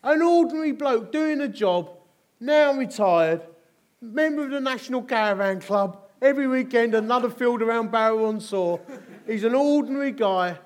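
loud, high, ringing sermon voice; preaching at full force. Acted. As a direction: proclaim it to the whole hall.

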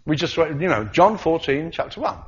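normal talking voice

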